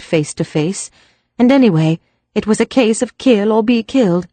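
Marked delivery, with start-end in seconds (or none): none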